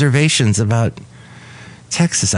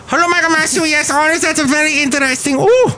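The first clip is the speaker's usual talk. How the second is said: Madame Rutabaga. Acted. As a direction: squeal it as a daffy high-pitched madame. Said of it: "so it's Madame Rutabaga".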